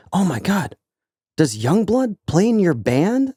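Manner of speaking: high-pitched, awed fan imitation; mock starstruck gasping question; starstruck stagehand. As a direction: draw out the question slowly, high and amazed.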